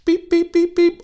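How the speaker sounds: high mimicked scanner beeps; mock checkout scanner beeping; till beeps.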